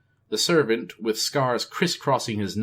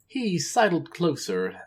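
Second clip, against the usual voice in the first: ordinary delivery, nothing marked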